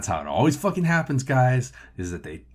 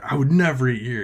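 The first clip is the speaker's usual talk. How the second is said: higher, louder indignant parting shot; total ass; 4 semitones higher, 5 dB louder than usual. Retold or imitated. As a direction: throw it back as an obnoxious customer. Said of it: "he turns into a total ass".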